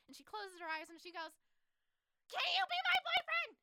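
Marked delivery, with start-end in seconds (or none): 2.3-3.5 very high, shouted confession; direct confession. Retold or imitated. Heroine closing her eyes to confess, shouting directly. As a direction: squeeze it out in one loud high burst. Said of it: "say it very directly".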